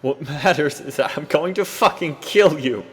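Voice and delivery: high, loud threatening yell; furious threat. Acted. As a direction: bellow the threat. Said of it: "I cried".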